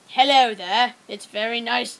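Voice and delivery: amateur British greeting; terrible British. Acted. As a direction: have a shaky go at a British accent. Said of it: "I can't do British".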